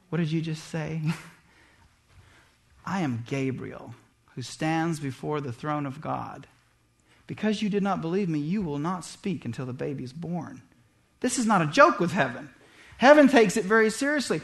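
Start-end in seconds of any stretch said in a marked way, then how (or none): 0.1-10.6 a hint of a deep, stern angel, lower and softer; lightly voiced angel, speaking low and grave. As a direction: Gabriel rebukes Zacharias, low and stern, only lightly voiced. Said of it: "I can picture him in that deep voice".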